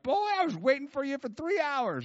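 high, loud Southern holler; Bob Jones. Retold or imitated. Prophet scolding a late caller, high Southern accent. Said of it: "giving you a little Bob Jones accent".

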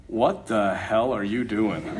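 low, slow, sleepy grumble; groggy roommate. Retold or imitated. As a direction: mumble it like a roommate dragged out of sleep. Groggy roommate woken early.

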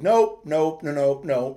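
loud, rapid-fire retold refusal; emphatic refusal. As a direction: reel off the refusals forcefully. Retold refusal to eat there.

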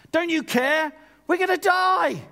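hammed-up high, loud wail; panicked cry. Disciples to sleeping Jesus in the storm, panicked cry. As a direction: cry out in mock terror, rising to a yell.

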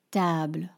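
table said as French tabl; French pronunciation. Shown as a demonstration. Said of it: "Table becomes".

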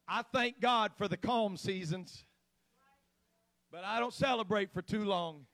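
normal talking voice

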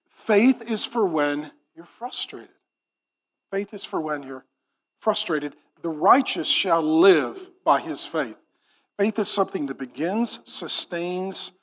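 low, quiet, deliberate sermon voice; grave hush over each phrase; hushed preaching. Real, not acted. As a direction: press the point home softly, with pauses. Hushed, weighty repeat of the theme.

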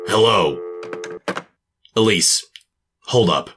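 calling out. Acted. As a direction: call out after her. Calling after Elise.

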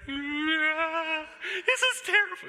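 mock whimper. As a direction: moan like the water is torture. Dreading getting into the water.

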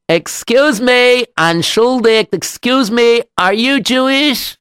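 high, slow, careful call; calling to a stranger. Acted. Rabbi asks a passer-by in Italy, high careful calling. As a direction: pitch each phrase up to be noticed.

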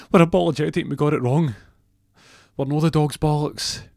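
high, loud, fast gabbled apology; blurted apology. Retold or imitated. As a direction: rush it out, loud and forced. Receptionist forced public apology.

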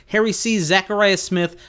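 ordinary talk, nothing marked

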